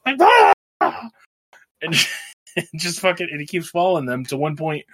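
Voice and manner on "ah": very high, loud jungle yell; Tarzan yell. Retold or imitated. Retelling the wild man's jungle call, Tarzan yell. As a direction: belt it out like Tarzan on a vine. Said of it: "yelling like he's fucking Tarzan".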